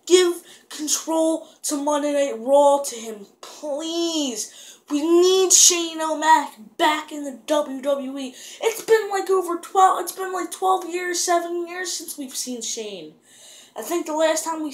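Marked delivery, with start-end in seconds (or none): 0.0-4.5 high, drawn-out begging; hammed-up fan's plea; retold pleading. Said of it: "I was like". 4.9-13.1 high-pitched, worked-up insistence; impassioned, pitched-up rant; fervent fan plea. Retold or imitated. Fan pleading for a comeback.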